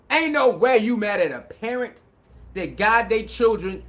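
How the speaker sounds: ordinary talk, nothing marked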